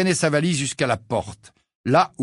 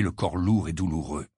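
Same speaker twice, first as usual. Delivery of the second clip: ordinary delivery, nothing marked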